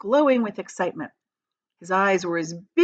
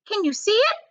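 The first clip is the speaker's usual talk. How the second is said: put-on high, mean old aunt; the greedy aunt, pitched high; aunt's voice; 3 dB louder than usual. Aunt Spiker eyeing the peach, high and greedy.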